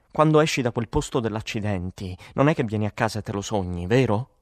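plain reading voice

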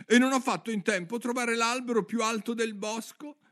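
worried pigeon complaining on and on; fretful pigeon. Acted. (piccione ansioso che si lamenta)

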